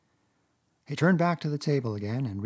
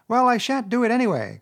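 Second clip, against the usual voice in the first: much higher and louder, heated refusal; excited defiant cry; 11 semitones higher, 8 dB louder than usual. A narrator's reading. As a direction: shout him down. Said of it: "cried Toad, getting excited".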